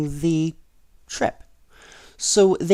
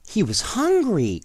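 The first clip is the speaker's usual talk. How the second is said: high, eager exclamation; a girl's excited realisation; excited cry; 8 semitones higher than usual. Acted. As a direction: exclaim it like you figured it out. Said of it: "cried Ruth".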